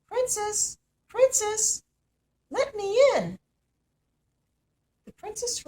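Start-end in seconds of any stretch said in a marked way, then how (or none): 0.1-3.4 very high, loud strange voice at the door; voice crying out. A narrator's reading. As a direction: call through the door in an eerie creature voice. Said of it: "a voice cried out".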